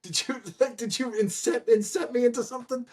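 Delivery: mock confused disbelief. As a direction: play the baffled fan meeting a stranger. Voicing Rain's confused thoughts.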